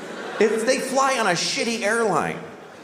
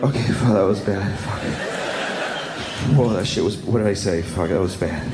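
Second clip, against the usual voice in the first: frazzled mutter; 9 semitones lower than usual. Retold or imitated. Frazzled parent alone by the car.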